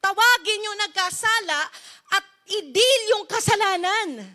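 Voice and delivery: God summoning Joshua to confront Akan, firm; God speaking. Acted. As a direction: call him to stand and face the sin. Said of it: "pinatayo ni Lord si Joshua".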